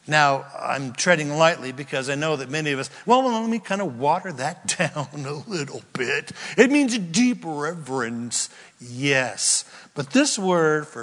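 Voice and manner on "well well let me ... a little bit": ordinary talk, nothing marked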